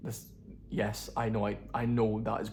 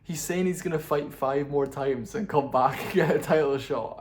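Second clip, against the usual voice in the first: louder, laughing as he talks; 2 semitones higher, 5 dB louder than usual. Real, not acted. Incredulous laughter in the voice. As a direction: vlogger laughing at Ferguson's comeback plans. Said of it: "That was so funny".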